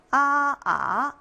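Mandarin tone one then tone two on 아; first and second tones. Shown as a demonstration. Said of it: "원래 성조가 네 가지가 있다고".

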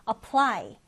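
apply with the stress on -PLY; stress on second syllable. Shown as a demonstration. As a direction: read out apply, leaning on the last syllable. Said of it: "디딤절의 강세를 두고". (apply, 뒤 음절에 강세)

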